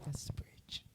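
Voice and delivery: a soft breathy whisper; whispered aside. Real, not acted. Telling friends the song part, whispered aside. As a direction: drop to a whisper. Remark after singing.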